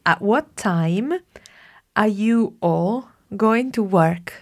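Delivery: spaced out for a learner; slow dictation. Shown as a demonstration. Teacher dictates the second sentence slowly to the student. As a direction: read the sentence slowly and clearly. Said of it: "Certainly we do".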